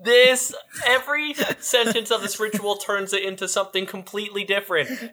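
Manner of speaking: high-pitched comic exasperation; incredulous protest. Real, not acted. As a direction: protest in rising disbelief. Mock outrage at the ritual.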